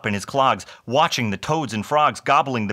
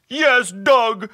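high, loud creature wail; hammed-up blubbering holler; hollering hobgoblin; 12 semitones higher, 6 dB louder, much slower than usual. A narrator's reading. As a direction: holler it out, drawn out at the end. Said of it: "hollered the hobgoblin".

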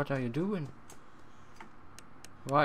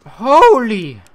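much louder, jolted; shocked exclamation; 8 semitones higher, 17 dB louder than usual. Real, not acted. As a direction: blurt it out after the scare. After jump scare.

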